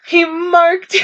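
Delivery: high-pitched panicked friend; high girly voice. Acted. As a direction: squeak it like a frightened teen.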